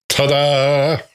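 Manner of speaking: trumpet fanfare. Retold or imitated. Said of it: "you almost hear trumpets going".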